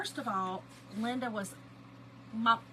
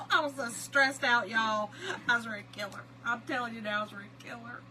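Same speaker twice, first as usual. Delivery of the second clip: ordinary talk, nothing marked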